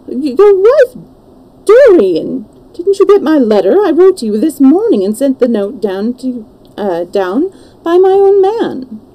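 high, loud, stumbling disbelief; astonished. Acted. Lord Henry learns Dorian means to marry, astonished. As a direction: splutter in amazement, pitch leaping up.

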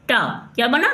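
ordinary talk

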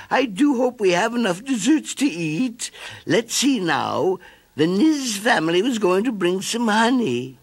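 high, fretful snail voice; fussing Mrs. Snail. A narrator's reading. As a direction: fuss over the party like a worried mother. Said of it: "Missus Snail fussed".

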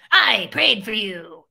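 slow, ominous witch voice. Retold or imitated. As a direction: make it sound like a threat. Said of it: "It was frightening".

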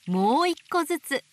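ordinary delivery

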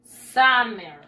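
"summer" said loud as SAH-mer; English pronunciation. Shown as a demonstration. Teacher translates verano for pupils, "summer" as SAH-mer. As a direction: hit the first syllable of "summer" with an open ah. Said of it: "en inglés se dice".

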